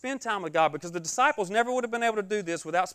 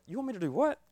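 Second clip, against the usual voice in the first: higher, incredulous rising question; mock disbelief; 5 semitones higher, faster than usual. Preacher retells Brother Marlon's message with mock disbelief. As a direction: ask it as if you can't believe the order. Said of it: "Brother Marlon's first messages was like".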